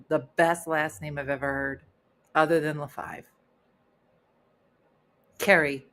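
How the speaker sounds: ordinary delivery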